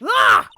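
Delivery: loud sudden gasp; a loud surprised cry; sharp startled shout; shocked gasp. Acted.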